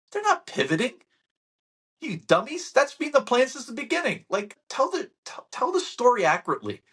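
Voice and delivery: high, heated "You dummies"; exasperated rant. Real, not acted. Scolding the media for calling it a pivot, exasperated. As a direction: scold the reporters.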